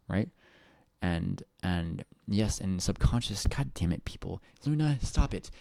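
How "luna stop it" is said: scolding the dog. Real, not acted. Scolding the pet mid-stream.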